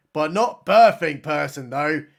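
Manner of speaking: ordinary talk, nothing marked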